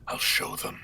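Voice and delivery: whispered threat; Omen voice line. Acted. Performing a line chat asked for, whispered. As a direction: whisper it like a looming shadow. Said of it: "Saw that line asked".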